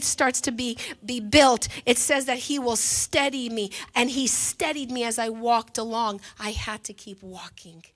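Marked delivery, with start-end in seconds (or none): 6.4-7.8 hushed whisper to herself; preacher whispers her resolve to the congregation. Real, not acted. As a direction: breathe it almost silently. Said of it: "whispered aside".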